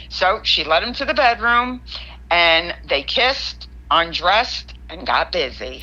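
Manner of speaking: usual speaking voice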